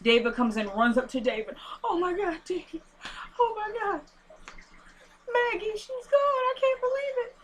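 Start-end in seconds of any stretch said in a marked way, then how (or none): 1.8-7.4 very high, soft mock-weepy voice; hammed-up grief in a thin high pitch; mock distraught. Veronica running up to David over Maggie, mock distraught.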